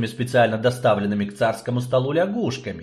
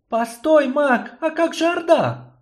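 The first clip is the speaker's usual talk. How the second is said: put-on urgent young sorceress; 3 dB louder than usual. Eltara calls out.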